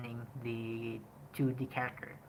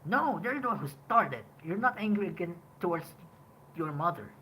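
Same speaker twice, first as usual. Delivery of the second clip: raised, higher-pitched angry protest; acted anger; 5 semitones higher, 5 dB louder than usual. Shown as a demonstration. Demonstrating Budi wrongly angry at his mother, angry protest. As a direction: argue back loudly, pitch jumping up. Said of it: "Woody is supposed to be angry".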